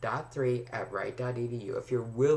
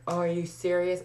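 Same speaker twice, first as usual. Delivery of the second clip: higher, louder mock disbelief; incredulous; 8 semitones higher, 4 dB louder than usual. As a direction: act out being stunned by the grade. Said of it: "I'm like".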